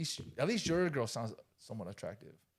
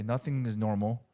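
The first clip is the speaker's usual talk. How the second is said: ordinary talk, nothing marked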